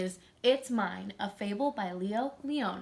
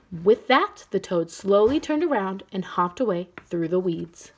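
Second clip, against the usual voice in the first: lively, louder storybook reading; reading to children; 8 dB louder than usual. A narrator's reading. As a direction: read it out to a class. Teacher reads picture book aloud.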